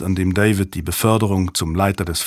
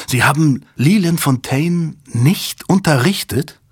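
higher, slow, incredulous question; disbelief; 6 semitones higher than usual. A narrator's reading. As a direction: Susan cannot believe what her boss did. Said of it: "starrte Strathmore fassungslos an". (fassungslos, ungläubig)

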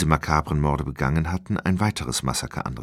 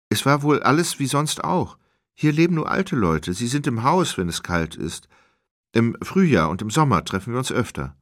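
high, louder woman character explaining; voice of the woman witness; 7 semitones higher, 4 dB louder than usual. Acted. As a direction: lift the pitch well up as the villager. Narrator voices Nini.

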